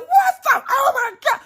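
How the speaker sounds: high, loud shriek of alarm; acted-out squeal of shock; panicked shriek. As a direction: shriek as if suddenly jabbed. Retelling panic at the swab.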